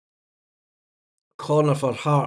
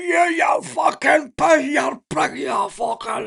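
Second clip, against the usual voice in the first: mock furious shout, higher and slow; imitating angry fan; 10 semitones higher, slower than usual. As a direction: ham up a raging fan in the stand. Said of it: "Big fat guy gets off his seat and he's".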